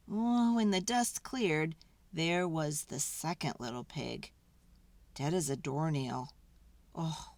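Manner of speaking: ordinary delivery, nothing marked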